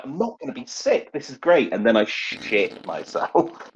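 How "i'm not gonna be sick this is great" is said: relieved. Retold or imitated. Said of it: "there's a couple of seconds where I'm like".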